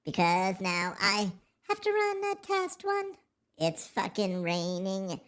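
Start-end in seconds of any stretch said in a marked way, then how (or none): 1.7-3.1 very high put-on squeak; silly squeaky voice. Streamer grumbles about running a test, silly squeaky voice. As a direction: jump up into a squeak.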